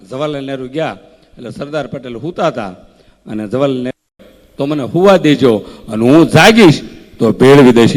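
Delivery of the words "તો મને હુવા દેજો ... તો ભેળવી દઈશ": louder, confident mock declaration; Sardar's bold reply. Sardar telling Nehru to let him sleep, loud and confident. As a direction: boom it out like an unbothered leader.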